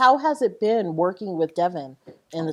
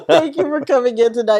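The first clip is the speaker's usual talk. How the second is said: laughing; 7 semitones higher, 8 dB louder than usual. Real, not acted. Still laughing while thanking guests.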